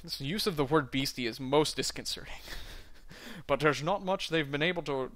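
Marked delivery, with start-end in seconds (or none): 3.5-5.1 Scottish burr, rolled and heavy, dwarf in a tavern; a Scottish dwarf doing his best Connery; Sean Connery impression. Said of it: "do a Sean Connery impression".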